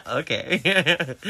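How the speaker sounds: high, giggly little okay; giddy giggling. Retold or imitated. Falling for the heartbreaker again, giddy giggling. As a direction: giggle helplessly around the word. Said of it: "I'm over here like".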